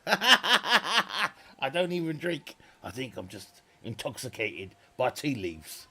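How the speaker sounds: laughing at himself; giggling while talking. Real, not acted. Laughing at sounding drunk.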